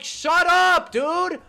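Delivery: high, loud angry shouting; angry yell. Real, not acted. Streamer yelling back at Rex Ryan on TV, angry shout. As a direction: tell him to shut up, loud and fed up.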